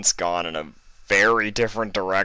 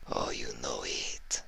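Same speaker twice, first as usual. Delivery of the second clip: put-on low, whispered northern-accented thug; menacing northern heavy; 7 semitones lower, 11 dB quieter than usual. Iceberg promising cruelty to the captives, low whispered menace. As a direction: purr it, low and sinister.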